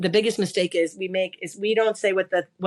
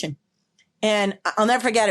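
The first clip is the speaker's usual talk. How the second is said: usual speaking voice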